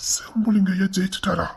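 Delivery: voiceless hushed question; stunned whisper; awed whisper. Acted. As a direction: ask in disbelief. Old man stunned.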